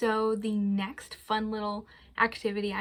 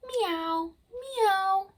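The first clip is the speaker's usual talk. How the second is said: higher mewing meows; cat meow; 8 semitones higher than usual. Pretend. Librarian imitating a cat for kids, mewing. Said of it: "Hear its funny sound".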